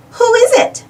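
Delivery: ordinary delivery, nothing marked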